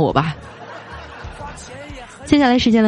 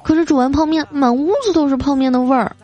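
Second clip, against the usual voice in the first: higher-pitched mock complaint; pouty wife; 4 semitones higher than usual. Wife objects to husband's cooking offer, pouty. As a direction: play a fussy wife finding fault.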